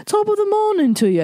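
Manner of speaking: exaggerated, high-pitched bad English accent; Renée Zellweger's English accent. Mocking an actress's first-movie attempt, hammed-up English accent. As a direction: ham up a fake, singsong British accent. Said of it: "the English accent".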